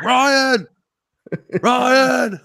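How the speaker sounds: high, loud call across the lot. Retold or imitated. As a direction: call him from the car, drawn out. Dad beeping, calling son.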